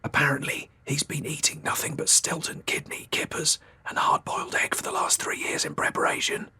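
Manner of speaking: gossiping whisper. Acted. Wife whispering gossip to husband, hushed. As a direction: share the juicy detail in a hush.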